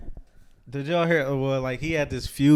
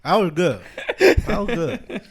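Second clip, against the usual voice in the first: amused laugh; 7 semitones higher, 6 dB louder, much slower than usual. Real, not acted. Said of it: "laughing at the joke".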